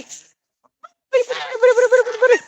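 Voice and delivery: very high repeated call of buddy; high-pitched chicken call; high sing-song call. Real, not acted. Calling the chickens over.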